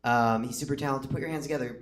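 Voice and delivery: usual speaking voice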